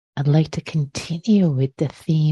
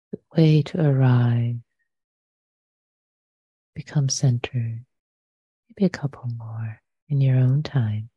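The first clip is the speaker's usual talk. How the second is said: unhurried meditation guidance with long pauses between phrases; guided meditation. Real, not acted. Teacher inviting listeners to settle into the body, guided meditation. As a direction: lead them gently into the breath, leaving lots of silence.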